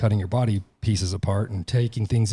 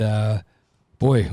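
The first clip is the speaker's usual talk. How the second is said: ordinary talk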